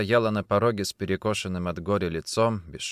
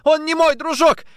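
very high, loud protest; shouting; 8 dB louder than usual. A narrator's reading. Woman denies he is her boyfriend, shouting. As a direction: yell back at the accusation. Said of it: "теперь тоже кричала".

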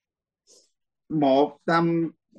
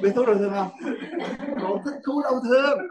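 cười trêu; 6 semitones higher than usual. Real, not acted. Teasing student about pleasure, laughing.

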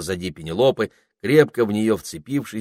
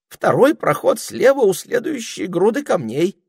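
trembling; 13 semitones higher, 7 dB louder than usual. A narrator's reading. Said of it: "дрожащим голосом".

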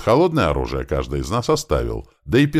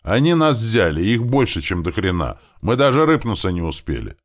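high, raised voice calling over; moderately acted yell; 7 semitones higher, 4 dB louder than usual. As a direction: shout the bad news across, easing off. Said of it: "вновь заорал он от машин".

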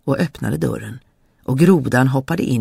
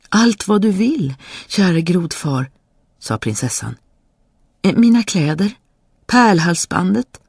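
princess; 6 semitones higher than usual. Acted. Princess pleading with frog.

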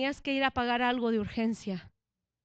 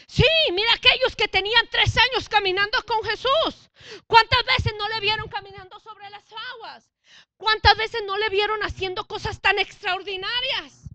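louder, higher sermon delivery; preaching at full force; 7 semitones higher, 9 dB louder than usual. Acted. Preacher pressing the congregation, at full force. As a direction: drive the point home to the congregation.

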